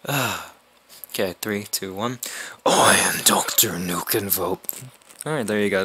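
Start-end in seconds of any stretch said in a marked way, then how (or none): 2.6-4.6 low, raspy villain whisper; deep voice. Acted. Best attempt at the villain's introduction, deep voice. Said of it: "That's the closest I'm ever gonna get".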